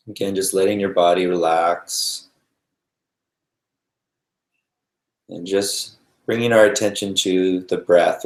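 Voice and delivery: calm meditation guide with long, restful pauses; guided meditation. Real, not acted. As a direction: ease them gently into noticing the breath, unhurried.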